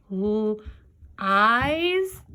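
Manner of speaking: L, then EYES, as separate parts; sounding out long I. Shown as a demonstration. Teacher trying it the other way, L and EYES sounded apart. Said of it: "try it the other way".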